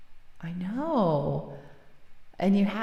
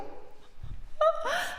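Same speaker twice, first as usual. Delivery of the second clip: breathy high giggle; laugh; 4 dB quieter than usual. Real, not acted. Streamer laughing at forgetting her own question, airy giggle. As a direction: let a soft airy laugh out.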